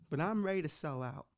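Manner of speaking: usual speaking voice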